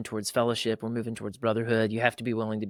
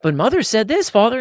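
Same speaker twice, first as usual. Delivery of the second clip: put-on child's protest; 11 semitones higher, 9 dB louder than usual. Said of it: "He's like".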